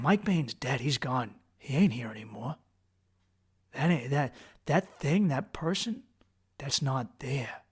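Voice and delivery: low, soft, halting realization; quiet, emptied-out disbelief; hushed desolation. Retold or imitated. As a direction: drop right down as if the self has gone. Panic collapses into loss of self.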